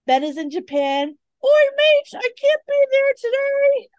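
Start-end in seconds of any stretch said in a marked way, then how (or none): 1.4-3.9 high, loud, bad Australian accent; attempted Australian accent. Host voicing absent co-host's excuse, attempted Australian accent. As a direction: have a clumsy go at Australian, pitched way up. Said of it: "I don't know how to do Australian".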